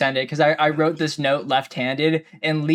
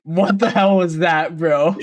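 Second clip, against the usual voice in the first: laughing, slowed in disbelief; startled by the clip, laughing; slower than usual. Real, not acted. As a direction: laugh through the question.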